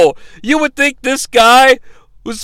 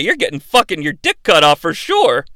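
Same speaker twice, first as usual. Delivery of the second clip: ordinary delivery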